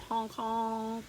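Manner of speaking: playful honking goose sounds; goose honks. Pretend. Reading to children, playful goose honks. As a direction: honk like a goose. Narrator voices geese honking.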